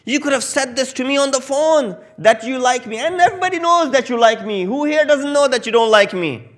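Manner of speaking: high, loud, indignant outburst at the friend; angry friend. Retold or imitated. Angry friend shouting at the one who called him back. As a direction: let the betrayed friend rage and protest. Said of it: "He got mad, furious, enraged".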